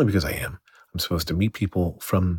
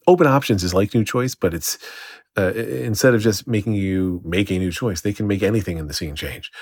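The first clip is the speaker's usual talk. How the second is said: ordinary delivery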